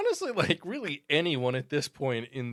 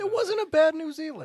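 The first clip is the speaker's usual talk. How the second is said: teasing insistence; 6 dB louder than usual. Real, not acted.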